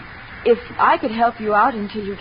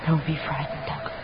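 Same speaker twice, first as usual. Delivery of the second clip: much softer, barely above a breath; hushed request; 5 semitones lower, 10 dB quieter than usual. Acted. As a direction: draw him close with a hush. Evelyn's seductive request to Douglas.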